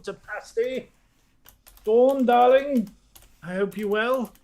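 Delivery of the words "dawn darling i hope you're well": usual speaking voice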